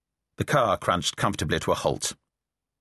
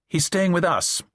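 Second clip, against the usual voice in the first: baldly; 7 semitones higher than usual. Acted. Isabel answers Charles baldly, no small talk. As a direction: blurt it out, no charm at all. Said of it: "she said baldly".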